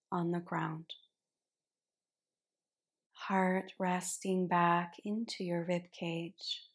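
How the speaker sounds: unhurried meditation cueing, letting each phrase settle; guided relaxation. Real, not acted. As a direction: guide them gently into rest, phrase by phrase. Guides relaxation at the start of yin yoga.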